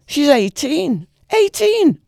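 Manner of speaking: rising to an outraged shout; outraged cry. A narrator's reading. Old man protesting to his daughter, rising to an outraged cry.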